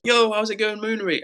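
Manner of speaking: high, upbeat reply to a mate; mock chat-regular's cheery hello; imitated greeting.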